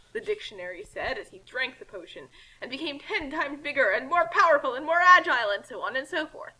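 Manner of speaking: ordinary delivery, nothing marked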